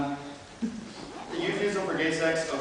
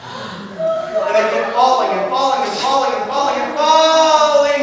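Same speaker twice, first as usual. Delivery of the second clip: repeated words rising higher and louder, drawn out; dramatic falling; 7 semitones higher, 8 dB louder, slower than usual. Acted. Performer relives a dream of falling, dramatic and drawn out. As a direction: stretch the repeats slowly, tumbling into a big loud cry.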